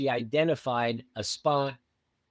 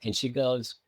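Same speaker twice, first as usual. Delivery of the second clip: normal talking voice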